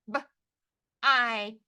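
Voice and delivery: short B, pause, long I; sounded out. Shown as a demonstration. Teacher tapping the first syllable, sounded out. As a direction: break b-I into single sounds. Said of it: "First syllable".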